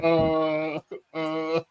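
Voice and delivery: long, drawn-out droning "uh"; imitated stunned "uh". Imitating the wait staff watching a fight, stunned "uh". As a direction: mimic dumbstruck onlookers with a stretched "uh".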